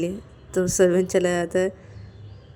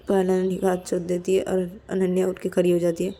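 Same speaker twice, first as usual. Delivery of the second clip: plain reading voice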